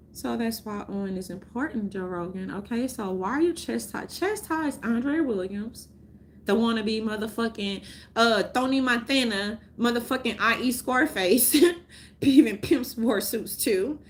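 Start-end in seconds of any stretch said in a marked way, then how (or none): none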